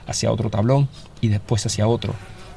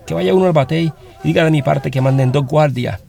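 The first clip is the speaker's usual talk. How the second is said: raised, higher commanding voice; shouted order; 5 semitones higher, 4 dB louder than usual. Acted. Sergeant sending a man for two guards, shouting the order. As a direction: bark the order out to the men. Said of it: "ordenó a gritos el sargento".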